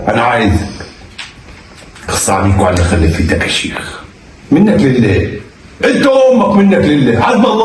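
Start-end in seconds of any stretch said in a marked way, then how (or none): 2.1-5.3 muttered curse. Acted. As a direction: grumble a curse under the breath. Father cursing son after call.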